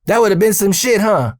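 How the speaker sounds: teasing, pitched well up; Earl joking. Retold or imitated. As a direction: imitate a boss amused at his own slip. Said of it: "And he goes".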